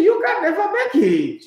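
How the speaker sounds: high, loud mocking voice, laughing through it; mock Thierry Henry rant. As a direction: ham up Henry scolding Lukaku. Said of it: "he says, he says".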